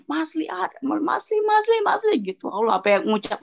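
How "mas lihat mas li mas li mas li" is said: retold panic, much higher pitch; panik menirukan diri. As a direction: imitate calling out in a high, panicky plea. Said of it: "Aku panik sebalik-baliknya". (memanggil dengan panik, suara meninggi)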